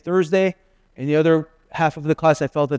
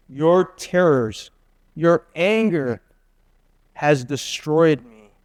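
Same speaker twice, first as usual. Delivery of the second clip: solemn scripture reading; much slower than usual. Acted. Reading lament verse slowly.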